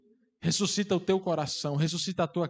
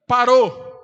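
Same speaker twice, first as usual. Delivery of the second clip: loud, higher one-word alarm yell; sudden shout; 5 semitones higher, 10 dB louder than usual. Acted. Someone yelling on a hospital ward, sudden shout. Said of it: "e alguém grita".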